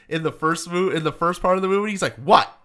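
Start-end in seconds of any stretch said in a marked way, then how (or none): 2.2-2.6 shocked what. Retold or imitated. Don Knotts' shocked reaction.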